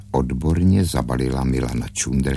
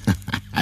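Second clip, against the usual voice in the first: short breathy snicker; mocking little cackle; gull's cackle; 5 semitones higher, 3 dB quieter than usual. A narrator's reading. Gull laughs at the stork, cackling. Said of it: "Racek se zachechtal".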